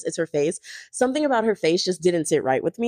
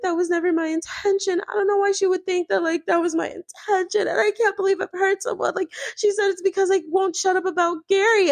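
high-pitched mock wailing protest; imitated crying; 10 semitones higher than usual. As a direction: whine it like a put-on sob story. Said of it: "Teresa instantly starts crying".

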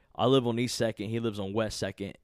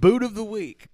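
high goofy voice; 9 semitones higher, 7 dB louder than usual. Shown as a demonstration. Segment name, repeated goofy.

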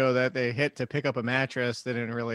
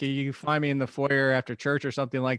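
ordinary delivery

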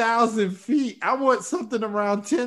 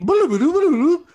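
time-warp bloop; 4 semitones higher, 6 dB louder than usual. Acted. Time travel arrival sound.

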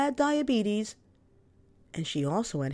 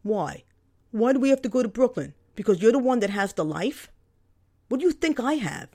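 louder, sharp indignant questions; heated argument; 3 semitones higher, 5 dB louder than usual. Acted. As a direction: snap back, fast and loud. Narrator arguing back at brother.

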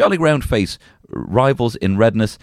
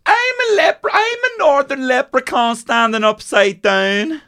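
much higher, slow, silly leprechaun squeak; slower than usual. Pretend. To cohost, a mock leprechaun perched upside down, high and squeaky. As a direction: pipe it up high like a tiny leprechaun showing off.

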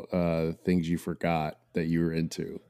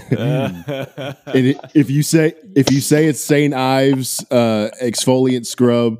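loud, raised-pitch playful outrage; mock threat; 7 semitones higher, 8 dB louder, slower than usual. Host jokingly threatening to end the call, mock outrage. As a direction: warn them with hammed-up indignation.

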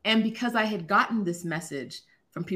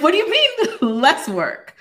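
high, loud, laughing disbelief; incredulous laugh; 11 semitones higher, 9 dB louder than usual. Retold or imitated. Reacting to her therapist's advice to do less, high and laughing. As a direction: cry out in amused shock.

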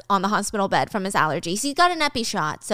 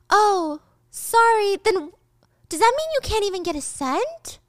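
high, timid pretend apology; innocent Gia; 10 semitones higher than usual. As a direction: act sweetly innocent.

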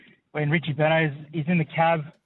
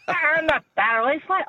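comedy falsetto, much higher than usual; high-pitched impression voice; 11 semitones higher than usual. Caller imitates a comedy cab scene, high-pitched voice. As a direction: squeak out the denial. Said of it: "he's got the really high-pitched voice".

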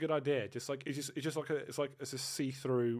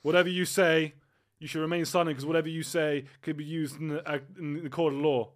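loud, higher hammed-up police officer; mock cop; 4 semitones higher, 10 dB louder than usual. Boyfriend plays a cop in a joking role play. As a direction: bark orders like a cheesy cop.

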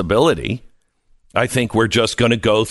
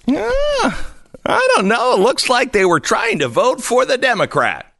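high, put-on voice of a clueless poll worker; mocking the ballot official; 12 semitones higher than usual. Host mocks Florida official reviewing ballots, high put-on voice. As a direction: play the official shrugging, much higher than your own voice. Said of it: "she took the ballot and said".

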